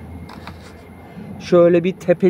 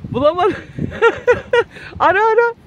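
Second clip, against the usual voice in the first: teasing laugh; 14 semitones higher than usual. Real, not acted. Mother teasing son, laughing.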